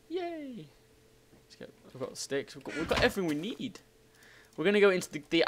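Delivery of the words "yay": high, soft little "yay"; tiny cheer. Pretend. Player getting a sword, tiny cheer. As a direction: squeak out a small cheer.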